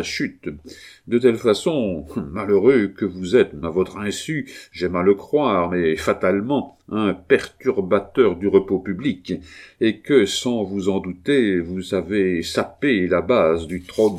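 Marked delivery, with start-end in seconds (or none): none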